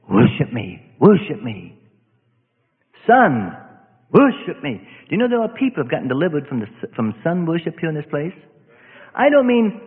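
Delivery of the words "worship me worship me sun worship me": low growling demand to be worshipped; demon voice. Retold or imitated. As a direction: boom it down like a spirit demanding worship. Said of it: "he said".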